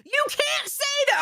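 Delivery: high-pitched, drawn-out shout; exasperated scolding. Real, not acted. Host tells Albert off for threatening to dox, exasperated shout. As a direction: cry it out in high, slow protest.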